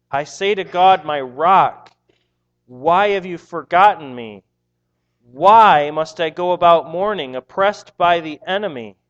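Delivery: psalm read like preaching at full force; scripture reading. Acted. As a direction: read the psalm with rising passion. Reads psalm with rising force.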